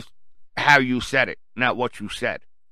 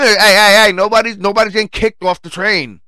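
loud, raised interjection as the conductor; raised-voice imitation of an official breaking up a row; calling for order; 6 semitones higher, 7 dB louder than usual. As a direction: cut in over a squabble. Conductor intervenes over the shouting.

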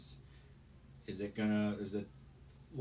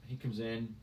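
normal talking voice

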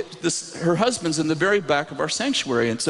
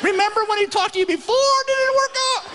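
fast, very high needling squeak; brain's voice; faster than usual. Acted. Brain reminding the preacher of past failure, squeaky mockery. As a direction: taunt in cartoon falsetto. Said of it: "in, in, in this voice".

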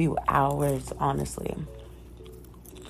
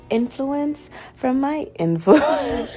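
laughing; 8 semitones higher, 5 dB louder, much slower than usual. Real, not acted. Laughs while tripping on the word.

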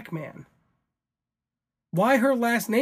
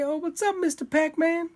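higher put-on greeting voice; silly character voice; 8 semitones higher than usual. Host greeting Pac-Man in a silly higher character voice. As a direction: greet him in a goofy high voice. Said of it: "Why is that the voice they talk like?".

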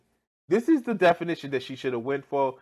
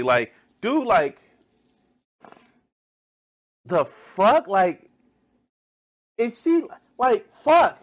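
louder and higher with each word, exploding; outraged disbelief; 9 semitones higher, 9 dB louder, slower than usual. Real, not acted. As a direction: build from disbelief to a yelp. Disbelief at the clip.

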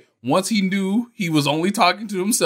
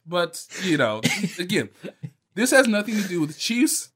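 ordinary delivery

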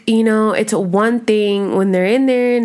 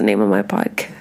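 whispered aside; 11 semitones lower than usual. Real, not acted. Embarrassed aside to listeners.

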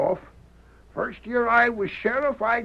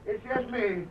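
fearful. Acted. Man begging not to be shot.

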